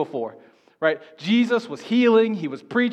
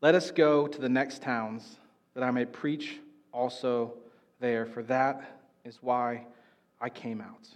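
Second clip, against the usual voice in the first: slow, lower and softer, settling; calm reply; 7 semitones lower, 6 dB quieter, slower than usual. Acted. Jesus answers the worried disciples, calm and slow. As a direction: answer gently and with quiet resolve.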